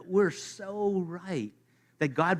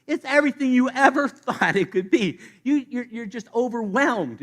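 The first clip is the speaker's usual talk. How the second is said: higher, louder, laughing through it; laughing joy; 8 semitones higher, 7 dB louder than usual. Pretend. Preacher pictures the joy of heaven, laughing. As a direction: bubble over with laughter.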